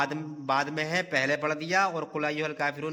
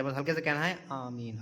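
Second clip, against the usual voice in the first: softly spoken amin; 3 semitones lower, 6 dB quieter than usual. Shown as a demonstration. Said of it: "ہلکے سے، تاکہ تمہیں آواز آ جائے".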